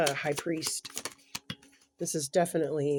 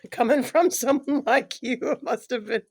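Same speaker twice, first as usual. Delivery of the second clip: higher and louder through laughter; laughing; 7 semitones higher, 9 dB louder than usual. Real, not acted. Reader laughing at client's imagined angry outburst. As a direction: speak while laughing at the picture.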